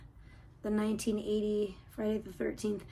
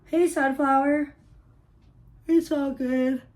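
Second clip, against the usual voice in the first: louder, slow call to the cat; 3 semitones higher, 14 dB louder, slower than usual. Real, not acted. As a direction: call her name across the room. Calling out to the cat.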